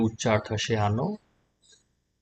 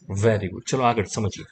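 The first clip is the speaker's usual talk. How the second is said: usual speaking voice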